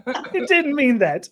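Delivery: laughing, slow. Real, not acted. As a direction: laugh it off slowly. Laughing denial of pun.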